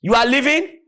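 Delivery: mother shouting gospel at fleeing robbers. Retold or imitated. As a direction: call after them to repent. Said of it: "she was shouting after them".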